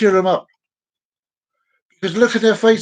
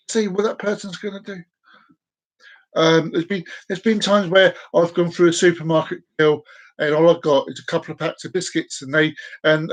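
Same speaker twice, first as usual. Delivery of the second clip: usual speaking voice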